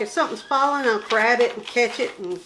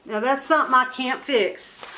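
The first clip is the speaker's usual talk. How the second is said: ordinary delivery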